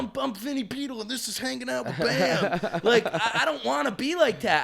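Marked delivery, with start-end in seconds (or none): 0.0-2.8 mock TV host. Mocking a TV intro.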